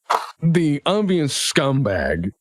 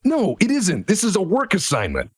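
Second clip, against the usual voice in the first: higher, firm contradiction; exasperated official; 6 semitones higher than usual. Acted. Official correcting a worker's lottery claim, exasperated. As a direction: cut them off, raised pitch, exasperated.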